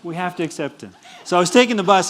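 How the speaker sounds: normal talking voice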